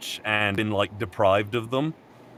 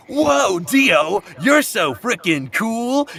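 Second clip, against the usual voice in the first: put-on much higher, slow awed British schoolboy accent; mock British accent; 9 semitones higher, 4 dB louder, much slower than usual. Schoolboys admiring Dio, higher mock British accent. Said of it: "the native British accent".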